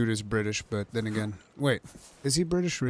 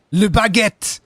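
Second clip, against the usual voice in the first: put-on loud, high, drawn-out French accent; intense mock French; 9 semitones higher, 10 dB louder, slower than usual. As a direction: shout it like a cartoon Frenchman. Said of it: "Intense French noises".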